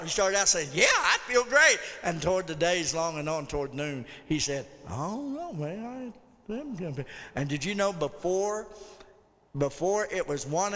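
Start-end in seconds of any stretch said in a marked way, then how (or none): 0.7-1.8 higher, louder cheerful retort; upbeat insistence. Retold or imitated. Coworker insisting he feels fine. 4.9-7.0 soft, faltering imitation of sickly man; mock ailing mumble; wavering self-doubt. Coworker starting to feel sick.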